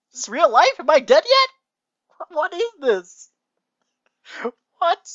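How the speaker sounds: very high, loud squeal of disbelief; real baffled shrieking through laughter; incredulous outburst. Real, not acted. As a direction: lose it completely, voice shooting up. Reader shocked by the story.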